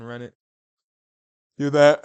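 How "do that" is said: sudden loud shout. Real, not acted. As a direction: yell it out hyped. Hyping a dream matchup.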